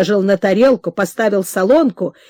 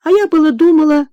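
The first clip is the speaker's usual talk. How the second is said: a higher, louder breath of relief; relieved sigh; 6 semitones higher, 4 dB louder than usual. Acted. Mother relieved the bell is not him, sighing. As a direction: let the worry go out with a sigh. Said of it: "облегчая грудь вздохом".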